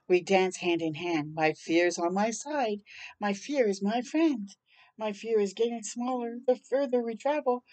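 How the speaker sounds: plain reading voice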